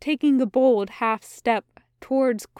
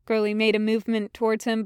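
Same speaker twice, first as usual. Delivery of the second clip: usual speaking voice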